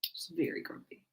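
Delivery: soft whispered aside. Real, not acted. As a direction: whisper it so the cat won't hear. Whispering about the cat.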